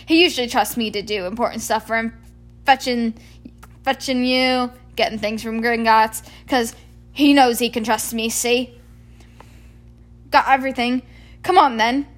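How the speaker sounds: loud, proud booming giant; puffed-up Hagrid. Acted.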